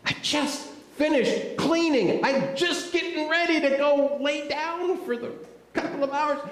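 high-pitched, put-upon complaint; exasperated protest. Acted. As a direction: object in a high, aggrieved voice. Acts tired Simon objecting to fishing.

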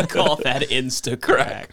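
slow smug tech-bro voice; paranoid tech bro. Pretend. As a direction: drawl the slogan like a convert. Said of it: "That's exactly what he does".

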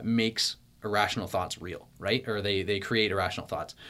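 ordinary delivery

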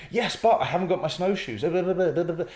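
high, loud whining excuses; whiny excuse. Shown as a demonstration. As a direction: brush it off with a moan. Acted yes-but reply.

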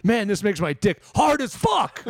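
slow loud mock tough guy; hammed-up cop line. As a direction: ham it up like a dumb cop.

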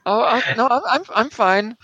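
high, stammering mock German accent; bad German accent. Immigrant told by security to calm down, bad German accent. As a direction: backpedal nervously in a fake German accent. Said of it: "the worst German accent ever".

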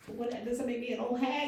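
high-pitched, incredulous retold question; mock indignation. Woman reacting to younger friends fretting about age, mock indignant.

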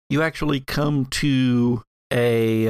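ordinary talk, nothing marked

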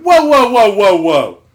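loud, high string of whoas, falling away; mock shout to stop someone; loud halting whoa. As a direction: imitate someone cutting you off loudly. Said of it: "and he said".

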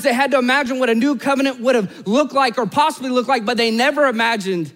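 high-pitched, driving preacher delivery; impassioned preaching. Real, not acted. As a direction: preach it with rising urgency. Preacher builds in intensity.

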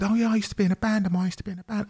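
high, quick, soft quote of the guest; imitating the guest. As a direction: toss it off high and lightly, as her remark. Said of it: "But then you said".

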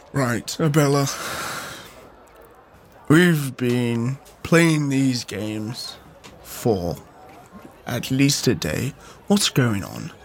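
low, slow, weary questioning; grave, measured demand for answers; serious Charlie confronting. Acted. Player voices Charlie confronting Abella.

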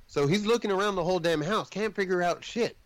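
usual speaking voice

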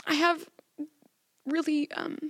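high, tearful, squeaky voice. Real, not acted. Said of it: "let me have my squeaky voice pass".

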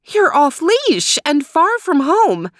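surprised mom fussing over a dog; high-voiced mother.

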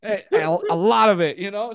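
normal talking voice

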